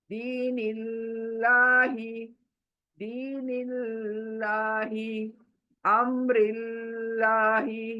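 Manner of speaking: very slow दीनिल्लाही with a thin लाम; thin Allah. Shown as a demonstration. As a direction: stretch दीनिल्लाही out, lips wide. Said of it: "बारीक".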